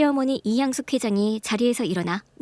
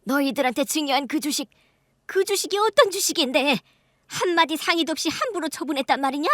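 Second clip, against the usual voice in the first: furious scolding; 7 semitones higher than usual. Acted. Mother-in-law and husband rage at the siblings, building to a shout. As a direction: scold the grown children, rising to a roar. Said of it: "불같이 화를 냈죠".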